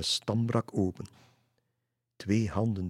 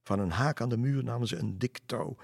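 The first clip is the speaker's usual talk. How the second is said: plain reading voice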